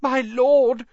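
high, frightened gasp of a plea. A narrator's reading. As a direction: gasp it out in terror. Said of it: "gasped the man on the floor".

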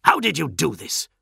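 loud, high demand, dropping at the end; angry demand. A narrator's reading. Enraged captain interrogates the postulant, demanding. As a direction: demand the answer in fury. Captain roars questions at Marduk.

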